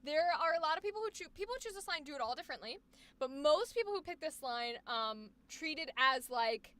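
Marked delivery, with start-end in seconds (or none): none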